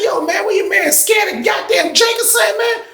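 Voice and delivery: high-pitched mock complaint; impatient challenge. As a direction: imitate an incredulous buyer, voice way up. Impatient buyer challenges him, retold.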